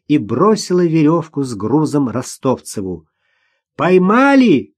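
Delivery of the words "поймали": high, loud call; shout. A narrator's reading. Girl who threw the rope, calling out. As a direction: shout it out across the ice. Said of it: "крикнула она".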